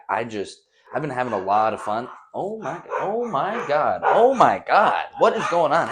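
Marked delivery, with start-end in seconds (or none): 2.3-5.9 higher, slow, alarmed oh my God; startled. Real, not acted. Dogs suddenly go off mid-video, startled higher voice. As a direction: caught off guard by a commotion.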